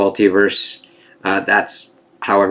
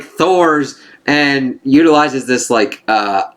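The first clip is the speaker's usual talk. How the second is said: ordinary talk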